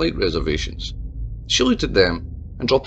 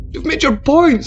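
much higher and louder; desperate cry; 7 dB louder than usual. A narrator's reading. Mayor cries out to Tabitha, desperate. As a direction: cry out to stop her. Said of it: "said Jim".

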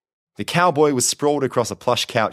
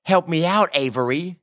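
higher-pitched mimicry in an American drawl; mocking echo; 9 semitones higher than usual. A narrator's reading. Prisoner throws Boone's plea back, mocking. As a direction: parrot the plea back with scorn. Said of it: "he mocked".

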